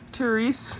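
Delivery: usual speaking voice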